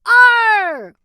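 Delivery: loud high pirate arr; hearty pirate cry; pirate shout. Acted. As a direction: bellow it like a pirate. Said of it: "They shout".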